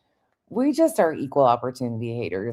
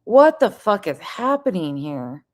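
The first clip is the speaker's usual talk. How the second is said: loud, high startled outcry; shocked outburst; 6 semitones higher, 5 dB louder than usual. Real, not acted. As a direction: yell it in disbelief.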